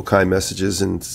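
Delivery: ordinary talk, nothing marked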